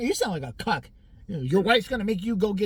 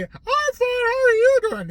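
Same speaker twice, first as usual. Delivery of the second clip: very high, louder mock chirpy voice; mocking Bernice; 9 dB louder, slower than usual. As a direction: squeak it out like a chatty neighbor. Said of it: "He's like".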